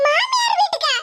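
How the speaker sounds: high, laughing disbelief; laughing mock astonishment; mock surprise. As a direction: scoff at the news with a laugh. Mocking neighbour's plan.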